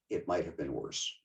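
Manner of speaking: a wry hushed afterthought; quiet echo. Retold or imitated. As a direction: let It might have been worse fall away like an echo. Quote repeated for effect.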